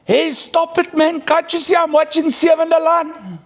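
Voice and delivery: high mock mum brushing the child off; imitated annoyed mother. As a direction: shoo the kid away, irritated and mocking. Mother snapping at her child.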